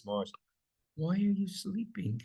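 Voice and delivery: mild quiet version; soft, flat question. Shown as a demonstration. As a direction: ask it gently, wrong take.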